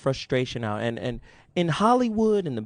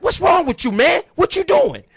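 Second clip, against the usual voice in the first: much higher, louder yelled quote of the angry friend; imitating Scott yelling; 12 semitones higher, 4 dB louder than usual. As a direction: shout it like the friend who just got elbowed. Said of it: "Scott is like".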